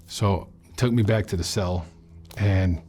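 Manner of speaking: ordinary talk, nothing marked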